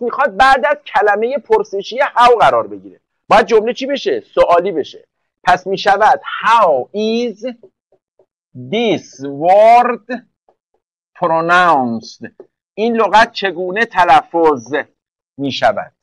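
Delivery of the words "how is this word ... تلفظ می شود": very slow, word by word with pauses; slow dictation. Real, not acted. Teacher assembling an English question for the class, slow dictation. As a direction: build the sentence piece by piece, drawn out.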